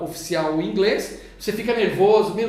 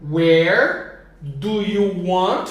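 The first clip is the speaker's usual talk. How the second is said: slow modeling; 2 semitones lower, much slower than usual. Shown as a demonstration. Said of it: "teacher models the question".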